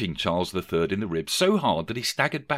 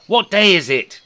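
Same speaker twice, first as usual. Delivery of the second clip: much higher, louder call out the window; shouted; 11 semitones higher, 9 dB louder than usual. Acted. Scrooge shouting to a boy outside, loud. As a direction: shout it down to the street. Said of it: "Rishi Scrooge shouted".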